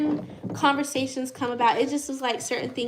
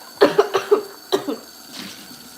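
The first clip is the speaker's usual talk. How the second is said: dry, rasping coughs; 4 semitones higher, 3 dB louder than usual. Real, not acted. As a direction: cough from an itchy throat. Why my throat is itching.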